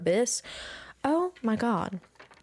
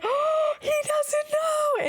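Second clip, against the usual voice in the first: very high, slow squeaky relief; relieved squeal; much slower than usual. Retold or imitated. Realising her crush guessed wrong, a very high slow squeal.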